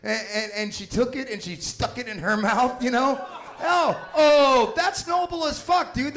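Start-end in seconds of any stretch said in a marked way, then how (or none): 3.6-6.1 high loud cry of admiration; half-joking shout of awe, high and loud; mock-awed shout. Real, not acted. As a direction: bellow it like it's the noblest thing ever. Moved outburst at the story.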